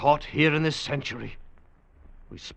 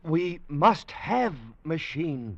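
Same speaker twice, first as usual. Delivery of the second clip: slow simple speech; 4 semitones higher, much slower than usual. Acted. Slow pidgin to Weena.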